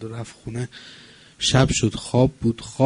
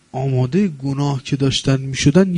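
ordinary talk, nothing marked

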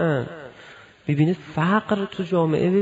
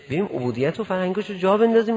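ordinary talk, nothing marked

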